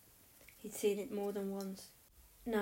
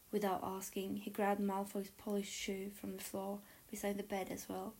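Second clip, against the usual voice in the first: usual speaking voice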